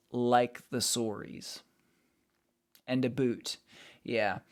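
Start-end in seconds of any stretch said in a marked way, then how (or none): 0.8-1.6 sorries with a rounded "oh", "sore-eez"; Canadian-style sorries. Shown as a demonstration. Streamer naming accent differences, sorries as Canadian "sore-eez". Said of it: "really distinct differences". 3.1-3.6 "a boot" for about, with a long "oo"; Canadian-style about. Shown as a demonstration. Streamer citing a Canadian trait, about as "a boot". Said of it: "That's not all Canadians".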